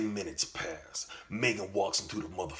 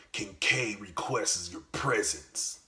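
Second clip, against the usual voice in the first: usual speaking voice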